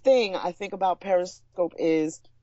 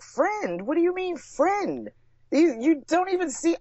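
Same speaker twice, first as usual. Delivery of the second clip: incredulous family; 11 semitones higher than usual. Retold or imitated. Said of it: "they're like".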